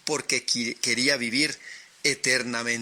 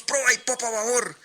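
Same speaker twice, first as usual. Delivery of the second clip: sailors shouting back; 10 semitones higher, faster than usual. A narrator's reading. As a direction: roar the order back like the whole crew. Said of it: "Y todos".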